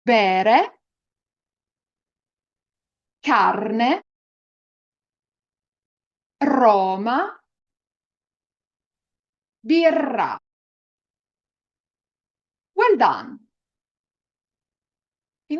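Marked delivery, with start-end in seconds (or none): 0.1-10.4 Bere, carne, Roma, birra with a clear tapped r; each word said slowly for learners to repeat; Italian rolled r. Shown as a demonstration. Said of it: "Let's repeat these examples".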